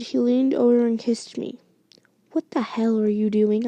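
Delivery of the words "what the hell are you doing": ordinary delivery, nothing marked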